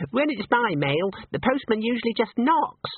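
put-on high, light young dancer's voice; giggly ballerina. A narrator's reading. Ballerina jokes about the mail, giggly. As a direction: make a silly joke, pitched high. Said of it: "said a ballerina, and giggled".

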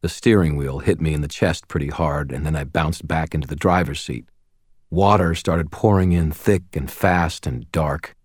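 usual speaking voice

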